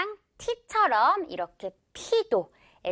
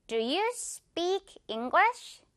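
slow rhythmic drill; 6 semitones higher, 3 dB quieter, much slower than usual. Shown as a demonstration. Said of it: "지휘해주는 이 박자에 맞춰서".

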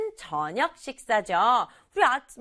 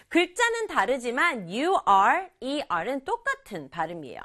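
normal talking voice

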